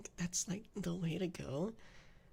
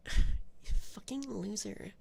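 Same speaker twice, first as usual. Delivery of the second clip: slow, louder sassy insult; bitchy sass; 2 semitones higher, 5 dB louder, much slower than usual. Acted. Streamer insulting chat with extra bitchy sass. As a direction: drag out the insult with full sass. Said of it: "put more bitchiness and sass into it".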